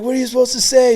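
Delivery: high, loud mock deputy; yelling female deputy. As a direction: imitate a woman DI demanding an answer. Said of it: "Like, yelling at him".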